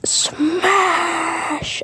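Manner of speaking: high, drawn-out attack call; mock battle cry. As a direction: stretch the word out high like a game move.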